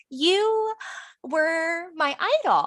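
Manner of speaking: high, halting mock heartbreak; retold disillusionment. Teen watching her idol teach shots, mock-crushed.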